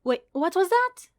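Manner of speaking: high teasing woman's voice; mock not hearing. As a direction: pretend you didn't catch it, playfully. Saray teasing, feigned deafness.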